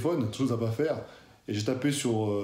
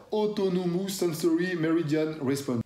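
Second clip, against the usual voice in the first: Autonomous Sensory Meridian Response spelled out slowly in a careful English accent, raised pitch; 5 semitones higher, slower than usual. Shown as a demonstration. Vlogger reads the English acronym out, slow careful English accent. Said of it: "attention, l'accent".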